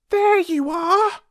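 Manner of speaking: very high squeaky little mouse voice; a tiny mouse piping up to reassure. Acted. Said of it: "said Mouse".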